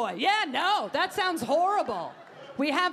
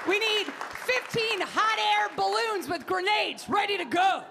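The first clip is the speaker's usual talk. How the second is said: higher, emphatic ranting list; mock Carly ranting; 6 semitones higher than usual. Comic mocking a candidate's wild demands, higher. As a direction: play the candidate demanding absurd things. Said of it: "She's like".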